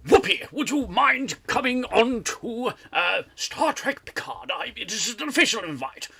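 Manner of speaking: high, loud theatrical impression voice; Picard. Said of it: "I'm gonna do my Picard voice".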